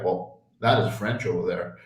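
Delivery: a low, soft mock of mom. Retelling mom at the grocery store, low and soft. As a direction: imitate mom quietly pointing out French food. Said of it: "she'd be like".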